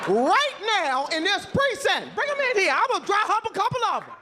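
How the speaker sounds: high-pitched mock-angry challenge; hammed-up comic outrage, insisting on it; mad. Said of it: "I was mad".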